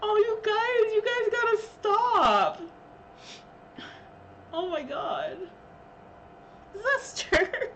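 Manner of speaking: high, slow tearful pleading; overwhelmed, voice wobbling; after crying. Real, not acted. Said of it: "I've been crying".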